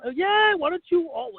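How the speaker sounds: very high, loud burst of agreement; acted-out sales excitement; retold eager salesman. Salesman seizing a group order.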